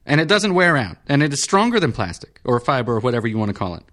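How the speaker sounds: high, fast peevish patient voice; irritable senator. Acted. Senator insisting to the surgeon on metal, high peevish.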